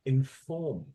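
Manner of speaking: Inform said in-FORM, stress on form; stress on second syllable. Shown as a demonstration. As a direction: model Inform with the weight on form. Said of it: "listen to the way I say it again".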